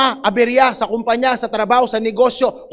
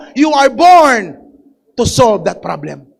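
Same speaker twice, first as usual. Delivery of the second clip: "born" stretched slow and loud; slow emphatic declaration; 2 semitones lower, 5 dB louder, slower than usual. Shown as a demonstration. Congregation told to declare it to their neighbor, slow stress. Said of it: "Sabihin mo".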